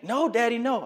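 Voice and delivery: put-on high whiny little boy's no; whiny child refusing. Son refusing his dad in a high whine. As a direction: plead like a stubborn small son.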